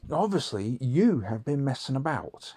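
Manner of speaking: dad pressing the point; accusing dad. Retold or imitated.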